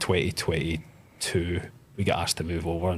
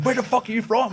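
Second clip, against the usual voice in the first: aggressive gym guy; 3 dB louder than usual. Retold or imitated. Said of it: "he was just like".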